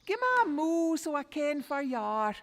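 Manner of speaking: high, loud call for the cow; tired boy calling for his cow. Acted. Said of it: "But he cried out".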